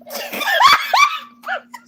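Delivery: high, shrieking burst of laughter; a real, helpless shriek of laughter. Real, not acted. Streamer cracking up at chat over Eric, shrieking laugh.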